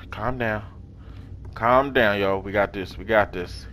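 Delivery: quietly calming himself in a tense spot; hushed self-calming. Real, not acted. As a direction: talk yourself down quietly. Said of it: "I'm skeptic right now".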